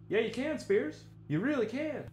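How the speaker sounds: reply to yourself as a high, soft stranger; other guy voice. Pretend. Answering his own question as someone else, high and soft. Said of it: "trying to make it sound like someone else".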